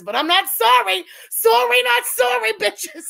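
put-on high, loud British soap accent; Coronation Street accent. Streamer refusing to apologize to viewers, loud mock British accent. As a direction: shout defiantly in a fake British accent. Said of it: "it's Coronation Street".

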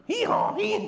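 high-pitched comic hee-haw; jokey imitation of a braying donkey. Donkey noise hints at avoided word.

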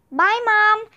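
shouting goodbye. A narrator's reading. Annie leaving the house, shouted goodbye. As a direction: shout it toward the house as you leave. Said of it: "Annie shouted".

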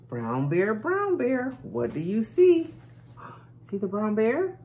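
sing-song picture book read; bright lilting read-aloud for little ones; reading to a toddler. Acted. Reading Brown Bear to kids.

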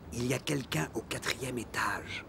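breathy hushed whisper. Acted. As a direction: keep it to a whisper. Anonymous call to police.

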